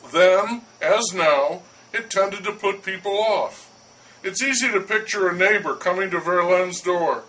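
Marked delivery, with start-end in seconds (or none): none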